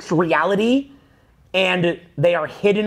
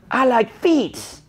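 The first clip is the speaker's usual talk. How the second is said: mock confession; 4 semitones higher, much slower than usual. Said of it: "you're like".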